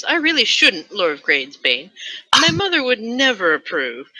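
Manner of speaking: coy lady. Acted. The lady refusing the lord's wine, coy high voice. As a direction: play the demure noblewoman. Reading the lady's line.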